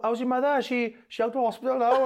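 high chatty neighbour voice; friendly local neighbour. Retold or imitated. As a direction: greet a neighbour warmly in the street. Quoting a friendly street greeting.

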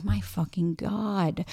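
slow, drawn-out, soft exasperated groan of disbelief. Retold or imitated. Reacting to a couple on her TV show, slow exasperated disbelief. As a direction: let it drag out, stunned and fed up.

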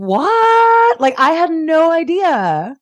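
high, loud, astonished cry; shocked what. Real, not acted. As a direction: let the disbelief burst out. Disbelief at her own realization.

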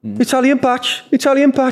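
put-on high Brummie boy calling out; Birmingham kid accent. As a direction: shout it like an excited Brummie teen fan. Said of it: "oh, I'm trying to get his accent".

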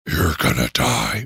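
low spooky voice. Shown as a demonstration. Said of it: "Scarier if it's like a".

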